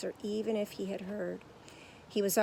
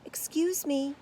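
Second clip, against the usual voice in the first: Paddington voice; 7 semitones higher, 4 dB louder, slower than usual. A narrator's reading. Paddington speaks to stagehand.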